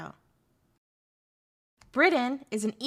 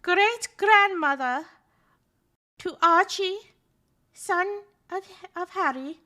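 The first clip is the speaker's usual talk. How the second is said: much higher, louder pompous announcement; mock royal herald; 9 semitones higher, 7 dB louder, slower than usual. Streamer presenting the Queen to chat like a herald. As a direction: proclaim her like a court herald.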